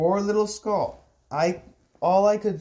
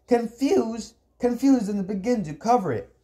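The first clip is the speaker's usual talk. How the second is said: high, incredulous outburst; exasperated, higher-pitched comeback; indignant retort; 7 semitones higher than usual. Acted. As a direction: throw it back in outrage, pitched up. Angry retort to his uncle.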